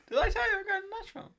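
high, fast, loud gush; excited announcement. Acted. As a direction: blurt out the news in a rush. Excited news of a trip.

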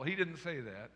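normal talking voice

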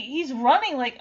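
usual speaking voice

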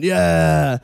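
loud, high, drawn-out mock cheer; crowd cheer. [name] supporters answering [name]'s slogan, crowd cheer. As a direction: cheer like a whole rally crowd. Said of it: "All the [name] are going".

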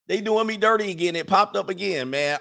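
high, playful grievance; aggrieved complaining. Real, not acted. Complains it happened on screen again.

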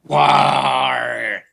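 loud pirate holler; pirate shout. Acted. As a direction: bellow it like a pirate. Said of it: "that was a live update".

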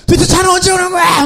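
high-pitched acted-out whine of exasperation; exasperated wail. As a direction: wail it like you are at your wits' end, replaying it. Acting out exasperated wait for car. (답답해서 높게 울부짖는 흉내)

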